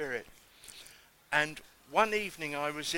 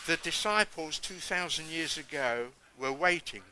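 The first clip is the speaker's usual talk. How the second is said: ordinary delivery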